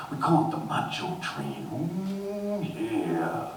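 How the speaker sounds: gravelly high wrestler growl; Macho Man Randy Savage. Retold or imitated. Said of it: "the Macho Man Randy Savage".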